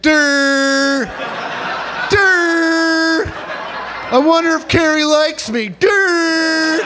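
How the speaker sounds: high, loud dopey durr voice; dumb student. Retold or imitated. Comic mocking classmates, high dopey durr shout. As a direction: play a dim kid mooning over Carrie. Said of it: "sixty percent of you are like".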